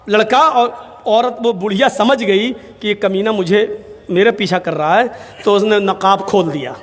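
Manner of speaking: ordinary delivery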